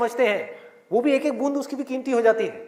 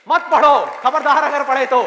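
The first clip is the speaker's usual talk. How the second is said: mock stern warning; 2 semitones higher, 7 dB louder than usual. Everyone forbidding you to study.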